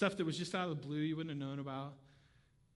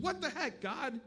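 higher, exasperated cry to God; 6 semitones higher than usual. Retold or imitated. Pastor yelling at God, exasperated and high. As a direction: throw the question up at God. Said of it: "I'm kinda yelling at God".

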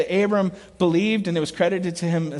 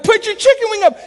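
high, loud coaching yell; 14 semitones higher, 6 dB louder, faster than usual. Retold or imitated. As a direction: shout it across the field to a kid at bat. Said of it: "I'm like".

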